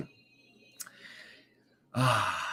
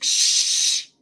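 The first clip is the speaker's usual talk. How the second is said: unvoiced shushing, a touch far off; hushing; 5 dB louder than usual. Host imitating a viewer hushing the chat. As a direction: shush everyone gently. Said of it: "She's like".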